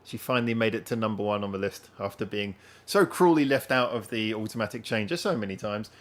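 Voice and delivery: usual speaking voice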